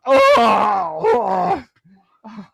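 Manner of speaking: loud, high ogre wail of pain; dopey cartoon bellow. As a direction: bellow it like a hurt dumb ogre. Ogre wounded, GM voices it.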